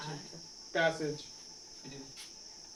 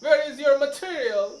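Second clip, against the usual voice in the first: mock English accent, higher and louder, slow Where; 3 semitones higher, 6 dB louder, much slower than usual. Shown as a demonstration. Teacher showing students how an Englishman talks, mock English accent. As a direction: ham up an Englishman asking for his material. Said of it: "अंग्रेज वाला कैसे बोलेगा?".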